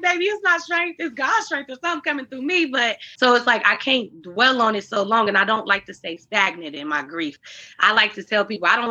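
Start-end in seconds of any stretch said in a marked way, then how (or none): 0.0-2.7 higher, knowing put-on reply; knowing correction. To people calling her strong, higher knowing correction. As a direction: gently set them straight, pitched up. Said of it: "I'm like".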